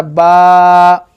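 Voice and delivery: ba held for two counts; drawn-out ba. Shown as a demonstration. Teacher stretching बा for the child. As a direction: stretch बा over two beats. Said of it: "इसको आपने उतना ही खींचना है".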